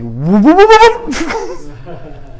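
whoops jumping high, then a laugh; startled whoops. Retold or imitated. Startled by numb lips, yelping whoops. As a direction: yelp it out in surprise, rising. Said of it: "That's my response".

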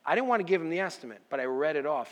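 normal talking voice

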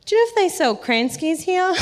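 high-pitched awkward question; mock-awkward retold question; awkward blurt. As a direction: blurt it out to a stranger, much too high. Nervous blurted question to a stranger.

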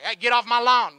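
put-on loud, cranky old-man yell off the porch; grumpy old man. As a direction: shout like a grouchy old neighbor. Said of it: "an old man that's sitting on his front lawn".

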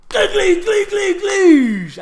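boards clattering off, loud and high; loud imitated clatter. Telling his friend how the boards flew out, a loud high mock clatter. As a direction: blast out a squeaky clatter sound.

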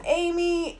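high, sustained call without extra volume; retold yell. Nick calling for Amy after her fall, retold yell. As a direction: call the name out, mock-yelling lightly. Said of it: "Nick yells".

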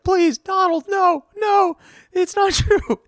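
high, loud, begging squeal; pleading Trudeau. Retold or imitated. Trudeau begging Trump at a meeting, high-pitched pleading.

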